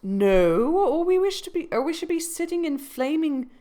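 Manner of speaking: sharp retort. Acted. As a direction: snap back at the old man. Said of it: "retorted the singer".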